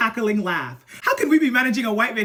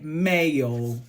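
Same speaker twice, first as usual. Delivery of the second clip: "Mayo" drawn out in an Essex accent, pitched down; 4 semitones lower than usual. Shown as a demonstration. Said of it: "how Essex people would have said it".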